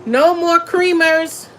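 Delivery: slow, drawn-out mock grief; mock lament. Real, not acted. Telling viewers about her diet, a slow mock lament. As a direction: mourn each word slowly, half-joking.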